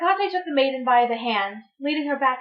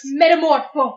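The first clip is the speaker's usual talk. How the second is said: spell shout; 3 semitones higher, 8 dB louder than usual. Acted. Casting a transformation spell.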